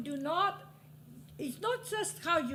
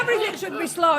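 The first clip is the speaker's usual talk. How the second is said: emphatic repeat; 2 semitones higher, 11 dB louder, much faster than usual. Real, not acted. Repeats joke line louder.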